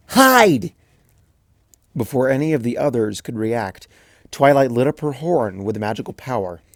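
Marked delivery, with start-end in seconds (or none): 0.1-0.7 loud high gleeful cry; yelled. Acted. Twilight fleeing her friends, loud high yell. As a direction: blurt it as you bolt.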